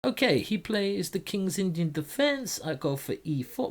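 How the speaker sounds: a light, playful fake Norwegian accent; mild mock Norwegian voice while explaining moves. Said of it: "Why am I talking like this?".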